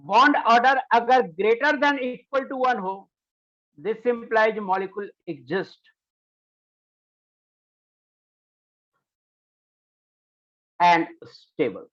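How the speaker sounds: measured, stretched-out phrases with pauses; slow dictation. Real, not acted. Teacher dictating when a molecule is stable, slow dictation. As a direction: dictate slowly so they can write it down.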